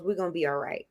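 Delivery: quiet, low inner voice stating it calmly; inner reassuring voice. Retold or imitated. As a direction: say it softly to yourself. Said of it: "that little voice goes".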